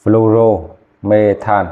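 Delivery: Fluoromethan drawn out syllable by syllable; slow clear naming. Shown as a demonstration. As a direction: spell out Fluoromethan slowly for students. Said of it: "ok chưa?".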